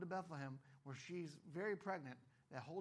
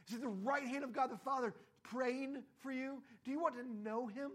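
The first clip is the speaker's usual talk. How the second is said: rising, louder urgent preaching; preaching at full force; 6 semitones higher, 6 dB louder than usual. Acted. Pastor urging the church, loud urgent appeal. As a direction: push them toward urgency, louder.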